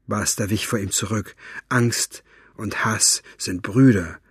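plain reading voice

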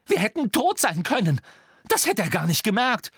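high, shrieked accusation; outraged, high-pitched tirade; furious reproach. A narrator's reading. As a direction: screech the charge at him. Hermine rages at Ron.

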